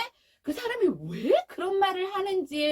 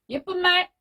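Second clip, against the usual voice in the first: curt correcting mother; 5 semitones higher, 5 dB louder than usual. Retold or imitated. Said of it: "이 엄마가".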